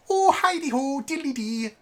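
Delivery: high-pitched mock-Irish lilt. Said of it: "Irish is another stereotypical accent".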